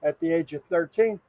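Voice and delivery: ordinary talk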